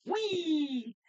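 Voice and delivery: gleeful whee. Pretend. Celebrating the character spin.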